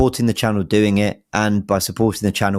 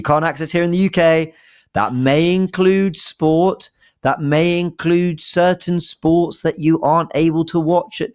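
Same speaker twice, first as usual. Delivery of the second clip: slowed, higher sponsor-read voice, lightly done; 8 semitones higher than usual. Acted. Podcast host reading the NordVPN ad to listeners, slow and upbeat. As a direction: sell the perk slowly and brightly.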